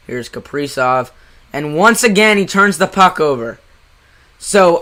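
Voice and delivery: live hockey call, brisk; play-by-play commentary. Acted. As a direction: call the game like a broadcaster.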